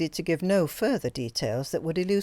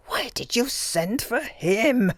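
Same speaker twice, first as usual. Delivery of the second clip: whispered, slow and venomous; angry hiss; 6 semitones higher, slower than usual. A narrator's reading. Anita demanding why Greta called him, slow angry whisper. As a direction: hiss the question through bared teeth. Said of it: "she hissed".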